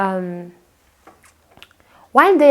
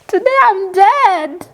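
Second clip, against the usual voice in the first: mock terror; 10 semitones higher, 4 dB louder than usual. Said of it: "I would just be like".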